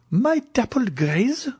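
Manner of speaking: shocked baroness demanding an answer; demanding in disbelief. A narrator's reading. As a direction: snap it out as she springs up. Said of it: "demanded the Baroness".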